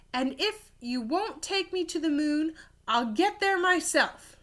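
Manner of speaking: cried out. Acted. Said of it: "and she cried".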